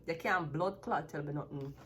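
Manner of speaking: ordinary talk, nothing marked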